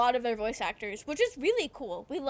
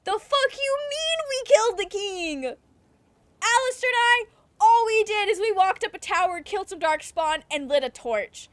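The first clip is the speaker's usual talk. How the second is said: incredulous angry outburst; 9 semitones higher, 6 dB louder than usual. Retold or imitated. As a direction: yell it in disbelief at a liar. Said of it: "And I'm over here like".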